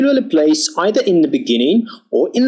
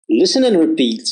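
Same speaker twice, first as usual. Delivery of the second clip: normal talking voice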